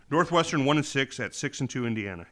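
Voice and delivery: normal talking voice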